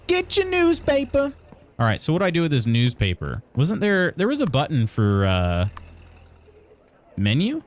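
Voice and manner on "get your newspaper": high, drawn-out street hawker; slow, high newsboy call; paper boy. Acted. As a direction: cry it out like a newsboy, pitched way up. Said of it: "Talk to the paper boy".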